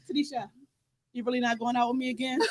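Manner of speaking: hushed imitation of the date; soft mock plea. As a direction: plead quietly. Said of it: "He said".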